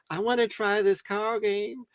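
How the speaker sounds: put-on girly voice. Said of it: "she's like".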